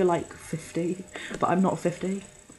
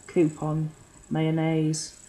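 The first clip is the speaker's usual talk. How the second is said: usual speaking voice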